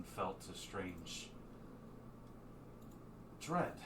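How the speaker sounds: soft, halting confession of unease; troubled Sphinx. Acted. Sphinx confiding dread to the party, troubled and hushed. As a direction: confide it quietly, with pauses before the worry.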